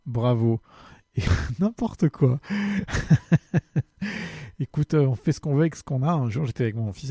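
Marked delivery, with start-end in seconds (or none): 2.4-4.5 bursting into a high laugh; a short amused laugh; real laugh. Real, not acted. Laughs at the compliment.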